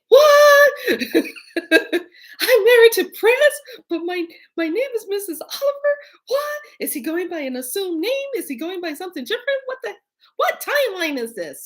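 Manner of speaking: very high, loud, laughing disbelief; shocked disbelief. Retold or imitated. Married to [name] in a dream, squealing high laughing disbelief. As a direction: squeal in stunned amazement. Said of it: "in my head, I'm saying".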